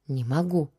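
ordinary delivery, nothing marked

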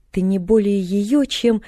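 a touch of anger, drawn out; restrained fury. A narrator's reading. Old man bristling at mention of the aunt, mildly angry. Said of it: "яростно начал он".

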